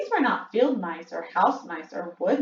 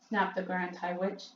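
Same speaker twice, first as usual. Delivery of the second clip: ordinary delivery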